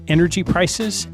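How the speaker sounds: ordinary talk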